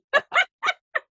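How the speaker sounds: a real laugh that rises at the end; high laugh. Real, not acted. Host laughing as her cat climbs out of the drawer. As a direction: giggle high in disbelief.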